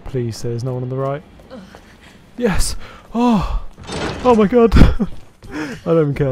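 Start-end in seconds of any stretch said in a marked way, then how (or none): 2.4-4.7 excited shout. Real, not acted. Relief after a tense escape. 4.7-6.3 laughing. Real, not acted. Relief after a tense escape.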